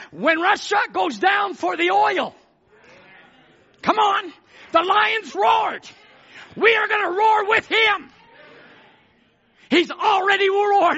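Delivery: high, loud proclamation; full-force preaching. Real, not acted. Preacher rallying church to roar with the lion, full-force preaching.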